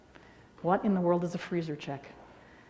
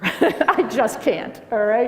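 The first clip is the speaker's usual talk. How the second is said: laughing; 3 semitones higher, 7 dB louder, much slower than usual. Real, not acted. Laughing refusal.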